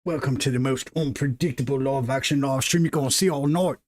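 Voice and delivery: a hint of a mock Australian accent, lightly put on; Steve Irwin Australian. Stream opener hamming up a lightly put-on Australian accent. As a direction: streamer greets viewers Croc Hunter style, in a light mock Australian accent. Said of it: "terrible, terrible accent".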